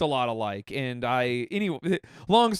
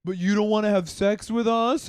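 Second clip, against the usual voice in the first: higher-pitched imitation of a seductive plea; mock sexy; 4 semitones higher, 4 dB louder than usual. Comic mimics women coaxing him into bed, mock sexy. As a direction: lightly mimic someone trying to be sexy. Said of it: "trying to be all sexy".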